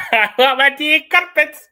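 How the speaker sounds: laughing through a hammed-up British accent, loud; mock British accent, laughing. Teasing friend repeats tea line in mock British accent. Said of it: "Are we British".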